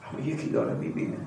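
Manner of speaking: quick hushed whisper. Acted. Preacher warning congregation someone sees, whispered. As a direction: lean in and breathe it secretly.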